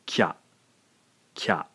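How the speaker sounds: "kya" as one syllable, k gliding into ya; one beat only for "kya"; blended syllable. Shown as a demonstration. Teacher repeating the blended sound for learners. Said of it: "a single blended syllable".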